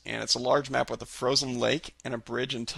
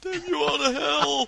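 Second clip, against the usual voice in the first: hammed-up dramatic curse; 13 semitones higher, slower than usual.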